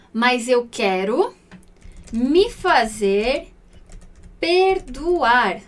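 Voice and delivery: very slow, word by word; slow word-for-word gloss; slow literal translation. Shown as a demonstration. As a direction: spell the literal meaning out slowly. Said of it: "mais ao pé da letra".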